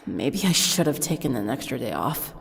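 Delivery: sigh, then low and soft; tired, talking herself down; weary sigh. A narrator's reading.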